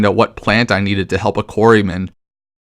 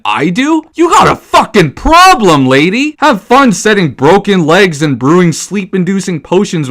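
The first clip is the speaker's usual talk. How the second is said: furious outburst; 6 semitones higher, 4 dB louder than usual. Real, not acted. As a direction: drag each word out in outrage. Furious rant at Johanka.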